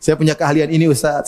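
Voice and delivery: ordinary talk, nothing marked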